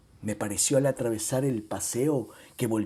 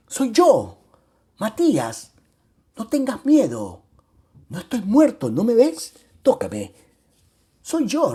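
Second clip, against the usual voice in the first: louder and higher, overjoyed; excited; 5 semitones higher, 4 dB louder than usual. A narrator's reading. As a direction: burst out in joyful reassurance. Joyful reunion, reassuring his brother.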